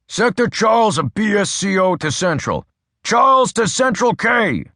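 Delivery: building radio shout; shouted radio call. A narrator's reading. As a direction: yell the call sign, straining to get through. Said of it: "Adams shouted".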